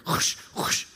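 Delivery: voiceless swishing brush noises; two rushing mouth-made whooshes; imitated footsteps.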